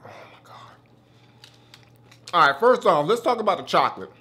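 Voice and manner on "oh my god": breathless whispered oh my God; awed whisper. Real, not acted. Vlogger whispering in awe at the chocolate bar.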